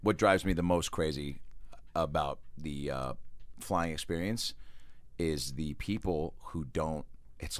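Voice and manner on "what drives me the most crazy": normal talking voice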